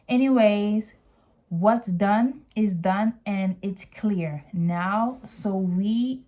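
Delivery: ordinary talk, nothing marked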